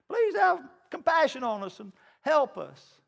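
high, earnest plea for help; pleading father. Acted. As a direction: ask for mercy as a desperate father. Father begging Jesus.

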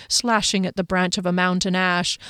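ordinary delivery

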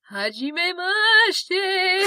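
high, very slow ghostly drawl; drawn-out ghost greeting. Shown as a demonstration. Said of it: "it sounds like you're trying to be tonal still".

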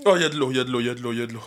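coughing out a plea for water; choking. Real, not acted. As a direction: gasp for water while choking. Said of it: "je m'étouffe".

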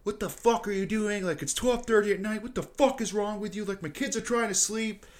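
high, mock furious neighbor; retold angry yell. Retelling the neighbor yelling at the party, high mock rage. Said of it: "just starts fucking screaming".

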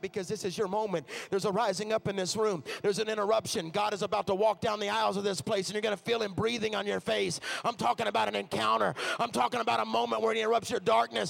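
loud, high, driving preacher's climax; preaching at full force. Acted. Preacher building the crowd up to a loud climax. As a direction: shout it out over the music like a revival call.

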